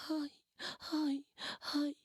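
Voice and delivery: hammed-up child sobbing; child crying. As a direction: wail like a little kid in tears. Friend starts to cry.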